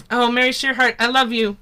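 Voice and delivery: ordinary talk, nothing marked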